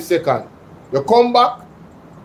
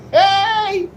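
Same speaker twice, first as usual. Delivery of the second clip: laughing shout. Real, not acted. Laughing exclamation after laugh.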